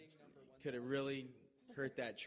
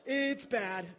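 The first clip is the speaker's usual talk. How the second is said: imitating Justin in pain; 7 semitones higher, 9 dB louder than usual. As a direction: ham up the injured friend crying out. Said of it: "do the Justin imitation".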